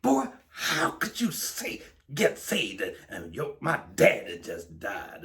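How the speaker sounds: high, loud angry outburst at his brother; furious shout at brother; furious retort. Retold or imitated. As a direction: snap back in grief-fueled anger. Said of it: "became furious".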